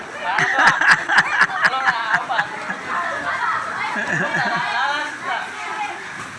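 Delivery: long high giggle; high laugh. Real, not acted. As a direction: laugh at the friend. Friend faking a drink.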